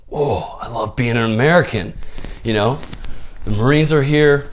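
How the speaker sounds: loud, high-pitched proud whoop; fired up. Retold or imitated. A kid cheering the Marines' arrival, fired up. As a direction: re-enact a kid bursting with pride, loudly. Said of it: "I'm fired up".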